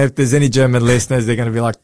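ordinary delivery

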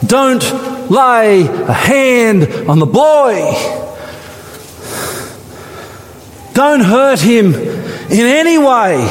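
slow, high, solemn command; angel commanding. A narrator's reading. Angel stopping Abraham, slow and solemn. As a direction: hold him back, slowly and firmly. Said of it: "called from heaven".

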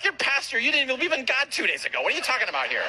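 high-pitched incredulous protest; comic disbelief. Retold or imitated. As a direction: question him in mock astonishment. Incredulous reply to cable guy.

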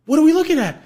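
high, loud puzzlement; baffled question. Retold or imitated. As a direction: squint at empty sky. Roommates confused.

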